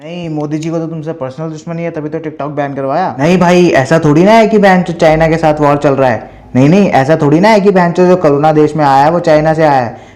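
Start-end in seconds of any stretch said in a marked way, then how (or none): 3.2-10.0 much louder, mock-innocent denial; loud sarcasm. Roaster mocking the TikToker's anti-Modi claim, loud sarcasm. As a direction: bellow it with fake disbelief.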